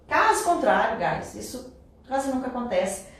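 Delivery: normal talking voice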